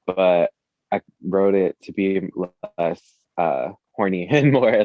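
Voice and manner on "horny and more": laughing. Real, not acted. Laughs at own word.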